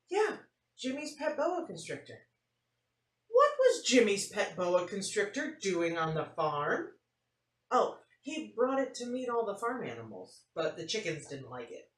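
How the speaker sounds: lively storybook read-aloud; animated picture-book reading; reading to children. A narrator's reading. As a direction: tell it like story time for kids.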